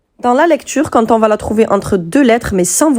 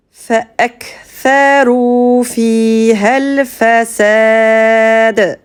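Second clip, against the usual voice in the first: récitation psalmodiée, lente et tenue; réciter le verset en tajwid, voyelles allongées; récitation coranique. Shown as a demonstration. (cantillation lente du verset)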